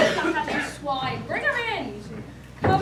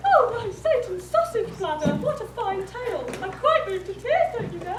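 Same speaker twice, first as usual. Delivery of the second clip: higher, louder gushing praise; gushing delight; 4 semitones higher, 3 dB louder than usual. Acted. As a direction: gush over the tale theatrically. Prince gushing over the tale.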